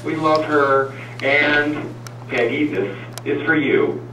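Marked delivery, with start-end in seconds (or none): none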